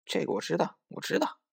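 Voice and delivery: cocky little bunny chiming in; boastful clamouring. A narrator's reading. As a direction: butt in, showing off what you know. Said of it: "它就在边上囔囔".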